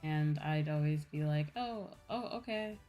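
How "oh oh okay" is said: normal talking voice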